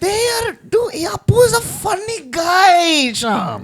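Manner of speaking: very high, strained comic voice; squeaky mock voice. Friends mock-defend Apu in a very high squeaky voice.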